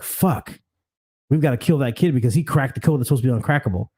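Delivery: usual speaking voice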